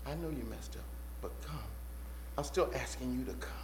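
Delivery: soft, low, tender invitation; gentle forgiving appeal. Acted. Jesus asking fallen Peter to come, gentle and patient. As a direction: invite him back quietly, pausing between phrases.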